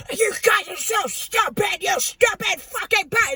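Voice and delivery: loud, high distorted demon shout; demonic voice. Retold or imitated. Possessed woman cursing her viewers, demonic shouting. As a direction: shriek the insult like something possessed. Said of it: "it sounded like".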